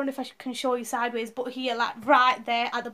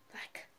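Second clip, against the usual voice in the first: breathy labour sighs; 20 dB quieter than usual. Retold or imitated. Showing breathing through contraction.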